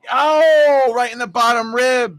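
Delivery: long loud, high "oh"; pained outcry. Real, not acted. Host watching the batter take it in the ribs, pained outcry. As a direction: wince aloud in sympathy, nearly shouting. Wincing at player hit in ribs.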